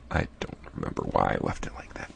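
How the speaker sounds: hushed aside; whisper. Real, not acted. Creator muttering about a glitch, whispered. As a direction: breathe it to yourself.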